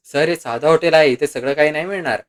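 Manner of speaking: usual speaking voice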